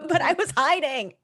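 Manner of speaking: ordinary delivery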